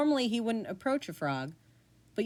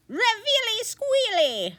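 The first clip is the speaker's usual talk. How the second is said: high, slow, sing-song spell call; hammed-up wizard incantation; 5 dB louder, slower than usual. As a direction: cast it like a grand silly spell.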